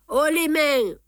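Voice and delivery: high, loud barked command; a sudden loud hold-up cry; gunpoint shout. Acted. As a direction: bark it out as you raise the gun. Ryland holds up Poirot at gunpoint.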